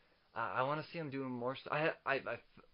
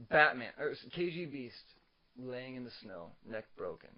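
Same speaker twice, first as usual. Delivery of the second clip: ordinary talk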